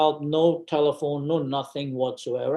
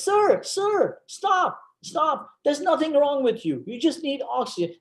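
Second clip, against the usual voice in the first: very high-pitched mock nurse, insistent; imitated nurse; 11 semitones higher than usual. Nurse telling the patient to stop, high insistent imitation. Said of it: "And then she says".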